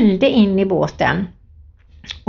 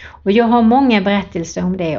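ordinary talk, nothing marked

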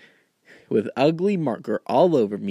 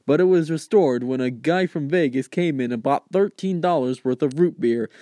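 normal talking voice

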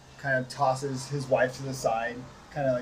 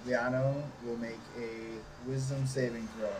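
ordinary talk

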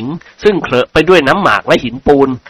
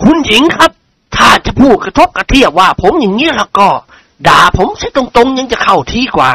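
put-on lightly raised voice of a huffy old lord; offended nobleman; 6 semitones higher than usual. A narrator's reading. Male character voiced in novel. (เสียงเจ้าคุณขุ่นเคืองเบาๆ)

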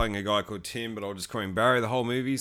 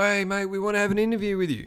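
lightly voiced pushy caller, pitched high; mock an eager big shot calling out; eager Hollywood caller; 8 semitones higher than usual. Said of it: "Hollywood's knocking on my door".

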